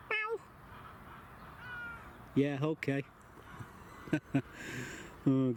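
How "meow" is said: high meow; cat meow. Retold or imitated. Imitating cat.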